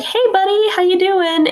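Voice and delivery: high, bright-eyed hello to a sad friend; chirpy buddy greeting. Retold or imitated. Coworkers checking on a moping friend, with a high cheery greeting. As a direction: pitch it up like a cheery pal checking in. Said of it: "and they're like".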